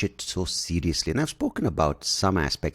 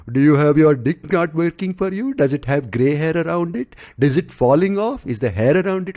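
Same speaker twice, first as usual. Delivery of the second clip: much higher, louder sleazy pitchman parody; mocking ad guy voice; 9 semitones higher, 5 dB louder than usual. Parodying a creepy erection ad pitchman, high and loud. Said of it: "Don't get that guy going".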